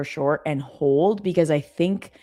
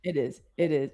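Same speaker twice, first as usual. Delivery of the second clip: ordinary talk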